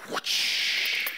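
long breathy whoosh; imitated whoosh. Preacher thinking up a third example, whispered whoosh. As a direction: blow out a rushing whoosh sound.

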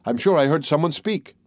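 ordinary delivery

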